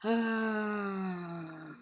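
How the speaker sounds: demonstrating a vocal release breath; vocal exhale. Shown as a demonstration. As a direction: let a full voiced sigh out. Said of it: "let it out through the mouth and vocally".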